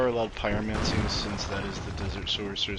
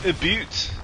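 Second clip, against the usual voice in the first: Scottish boot; 8 semitones higher, 3 dB louder than usual. Retold or imitated. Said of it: "I've heard Scottish people say".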